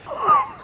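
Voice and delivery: very high squealing laugh; delighted laugh. Real, not acted. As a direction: burst into a high-pitched laugh. Delight at old signs and prices.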